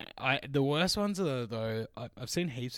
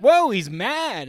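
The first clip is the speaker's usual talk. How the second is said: high, loud, slow mock keeper surprise; snake keeper impression; 11 semitones higher, 12 dB louder, much slower than usual. Snake keeper pulls out his angry snake, slow loud mock whoa. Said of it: "And he's like".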